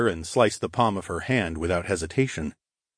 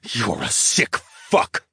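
disgusted; much slower than usual. Acted. Disgusted insult.

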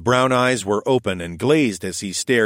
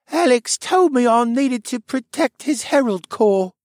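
put-on high, faraway boy voice, half asleep; sleepwalking child, dreamy and distant; 3 dB louder than usual. A narrator's reading. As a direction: speak as if lost in a dream. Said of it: "he said in a dreamy voice".

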